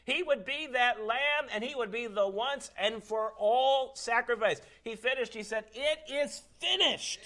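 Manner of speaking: high, loud proclamation, slowing on the last words; full-force preaching. Real, not acted. Preacher proclaiming Christ's finished sacrifice, full force. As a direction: climb to a ringing climax.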